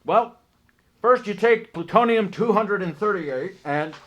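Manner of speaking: loud, high character voice; conductor voice. Acted. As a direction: boom it out as the conductor. NPC explains fusion in voice.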